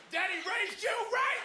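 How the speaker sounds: high, loud proud-dad cheer; proud shout. Retold or imitated. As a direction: shout it out like a thrilled father. Proud dad shouting across the crowd.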